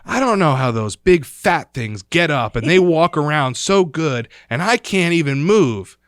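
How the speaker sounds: loud, high imitation of an indignant grandma; complaining grandma.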